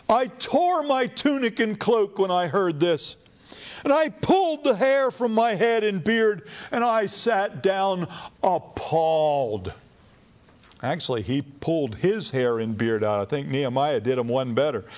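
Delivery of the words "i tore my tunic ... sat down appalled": raised, anguished cry of grief; tearing his clothes in shame; grieved Ezra. Acted. Preacher voicing Ezra's grief at intermarriage, anguished. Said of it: "Ezra is deeply grieved".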